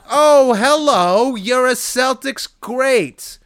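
slow, high, drawn-out mock greeting; mock awe. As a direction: gush with fake reverence.

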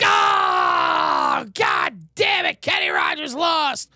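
mock groan of a disappointed crowd. Crowd reacting as Kenny loses, mock shouted groan. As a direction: shout the loss like a let-down gambler. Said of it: "Everyone's like".

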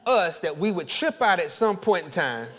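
loud, high, impassioned preacher's cry; fiery preaching with a laugh; full-force preaching. Acted. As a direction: preach it out at the top of your voice, laughing through it.

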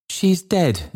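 put-on high, anguished old man's exclamation; Trotty. A narrator's reading. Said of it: "exclaimed the old man".

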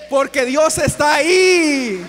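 loud, slow, drawn-out cry; preacher's shout. Real, not acted. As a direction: shout it out to the congregation. Predicador exclama ante la congregación. (grito de predicador, alargado)

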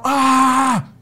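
loud, high yelled "aah"; grito al hijo. Retold or imitated. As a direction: yell out a long open scream, reenacting it. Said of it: "Y le he gritado". (grito fuerte y agudo, recreado)

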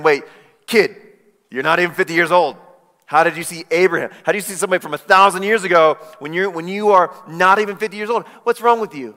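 fast, loud, incredulous mockery; sarcastic. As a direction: scoff at him like he's a silly kid. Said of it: "it's a sarcastic question".

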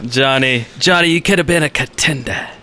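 usual speaking voice

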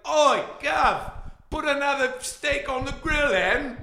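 high-pitched, broad mock Australian-English accent; English veering Australian. Shown as a demonstration. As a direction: call out in wobbly English-turned-Australian. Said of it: "That's Australian".

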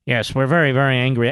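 low, mock-solemn indignation; mock angry media. Host mimicking angry media, mock-solemn. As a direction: drop low in feigned grave anger.

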